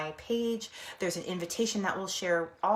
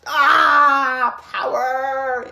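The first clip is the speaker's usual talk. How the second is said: triumphant cry; 5 semitones higher, 13 dB louder, much slower than usual. Retold or imitated. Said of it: "I'm like".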